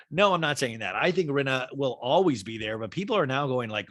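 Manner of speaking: usual speaking voice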